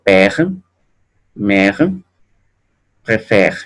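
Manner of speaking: "père, mère, préfère" with open eh before the R; word by word, open the E in "père, mère, préfère". Shown as a demonstration. Teacher reading grave-accent examples, open È. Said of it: "Un poco más abierta".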